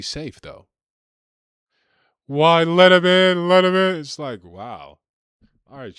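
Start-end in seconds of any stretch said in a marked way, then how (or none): 2.3-4.0 much higher and louder, a shrieking parody; hammed-up indignant activist shrieking; mock shrill outcry. Mocking open-border voices answering his plea, a loud high shriek.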